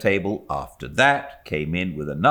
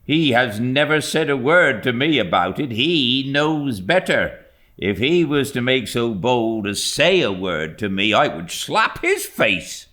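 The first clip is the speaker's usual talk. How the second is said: servant Peggotty; 6 semitones higher, 4 dB louder than usual. A narrator's reading. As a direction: huff it out like a flustered nurse. Peggotty voiced in story.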